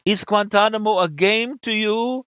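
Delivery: outraged French woman, high and drawn out; scandalized French interviewer demanding an answer; mock scream. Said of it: "screams at me".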